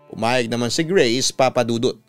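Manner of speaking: usual speaking voice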